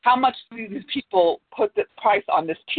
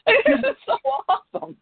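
much higher, gushing with a laugh; playful gush; 13 semitones higher than usual. Real, not acted. Reader gushes over the story, playfully high with laughter. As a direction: squeal it out in fun.

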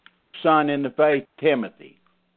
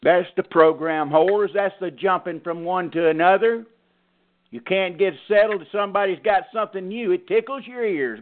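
higher, emphatic pulpit preaching; 7 semitones higher than usual. Acted. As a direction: drive the point home to the flock. Pastor preaching at congregation.

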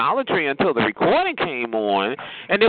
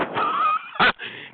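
short, very high-pitched laugh. Real, not acted. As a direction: squeal briefly with laughter. Laughing at own verdict.

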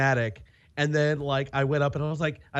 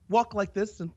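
ordinary talk, nothing marked

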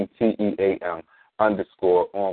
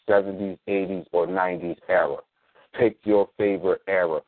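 ordinary talk, nothing marked

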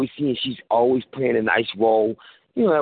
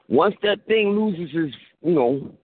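ordinary delivery

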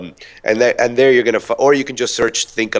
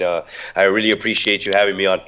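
ordinary talk

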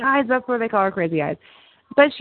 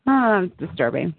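ordinary talk

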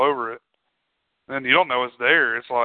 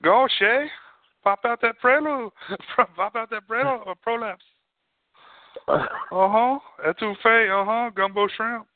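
high-pitched mock Cajun babble; much higher, playful Cajun impression; mock Cajun accent; 9 semitones higher than usual. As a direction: ham up a cookbook Cajun accent. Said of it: "We act like we can't understand anything Cajuns say".

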